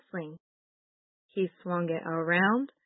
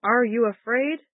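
booming taunt, louder; taunting giant shout; 4 semitones higher, 7 dB louder than usual. Acted. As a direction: call it out like a giant. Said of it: "Goliath shouted".

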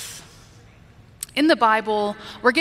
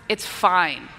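low, terse resignation; not really fine; 3 semitones lower than usual. Shown as a demonstration. Preacher showing how tone changes the message, not really fine. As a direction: say fine while meaning the opposite. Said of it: "Very, very different messaging there".

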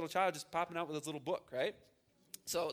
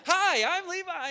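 much higher, louder mock child greeting; imitating little boy; 13 semitones higher, 10 dB louder, slower than usual. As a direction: chirp it like an eager small boy at a door.

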